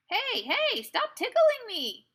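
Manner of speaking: high, loud squeal of protest; ticklish shriek. Acted. Pippi to the bull charging at her, ticklish shriek. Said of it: "shrieked Pippi".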